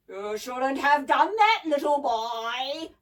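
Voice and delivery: loud, slow, menacing snarl; drawn-out mechanical monster threat; snarling gramophone giant. A narrator's reading. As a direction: snarl it slowly in a booming voice. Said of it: "snarled the gramophone".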